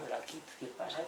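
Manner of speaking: soft and fed up under the breath; exasperated mutter. Retold or imitated. Desperate visitor muttering to himself. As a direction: mutter it in frustration.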